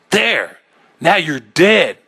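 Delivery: high, loud, unhinged triumph; hysterical scream. A narrator's reading. Man after smashing the mirror, hysterical scream. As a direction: scream it on the brink of madness. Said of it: "I screamed".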